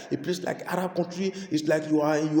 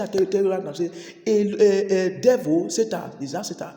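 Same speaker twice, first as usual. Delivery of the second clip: ordinary delivery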